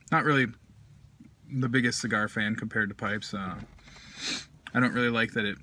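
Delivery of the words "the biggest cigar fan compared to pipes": ordinary delivery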